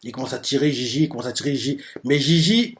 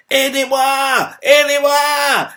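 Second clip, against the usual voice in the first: much higher, desperate call, the second one drawn out slowly; cry for help; 11 semitones higher, 4 dB louder, much slower than usual. Acted. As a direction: wail for help trapped inside. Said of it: "Elle crie".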